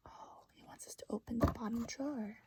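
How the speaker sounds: barely-there whisper; teacher whispering to first graders; hushed aside. Real, not acted.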